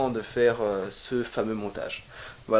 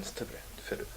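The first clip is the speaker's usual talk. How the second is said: whispered, begging aside; whispered plea; 13 dB quieter, faster than usual. Real, not acted. Whispering a plea to viewers to subscribe. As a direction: breathe the request almost unheard.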